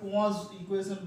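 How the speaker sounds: পোয়াজ pronounced the French way, "po-waz"; French-style pronunciation. Shown as a demonstration. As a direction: say পোয়াজ as "pwaz", with no n sound. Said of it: "পোয়াজ equation এইরকম নামটা".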